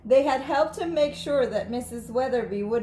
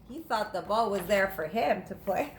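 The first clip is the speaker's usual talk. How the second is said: ordinary delivery, nothing marked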